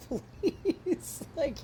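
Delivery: breaking into real laughter mid-sentence; laughing. Real, not acted. Storyteller imagining calling police over a prank, laughing. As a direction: let a laugh take over before the last word. Laughing at what she would've done.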